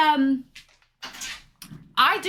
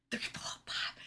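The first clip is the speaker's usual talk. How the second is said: whispered; 18 dB quieter than usual. Real, not acted. Whispering a count.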